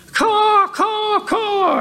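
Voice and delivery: high shouted mock caws; crow caws. Preacher voicing worldly crows with shouted high caws.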